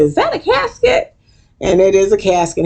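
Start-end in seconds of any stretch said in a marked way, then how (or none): 0.0-1.1 high, startled; shocked question. Retold or imitated. Guest spotting the coffin, high alarmed question. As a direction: gasp it out in alarm. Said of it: "turned around and said".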